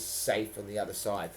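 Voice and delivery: ordinary delivery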